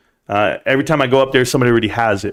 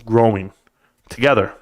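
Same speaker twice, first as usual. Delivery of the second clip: ordinary talk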